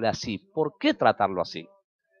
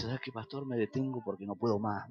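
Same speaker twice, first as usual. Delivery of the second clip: tearful quiet; 3 semitones lower, 11 dB quieter than usual. Retold or imitated. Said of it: "empezó a llorar el chico".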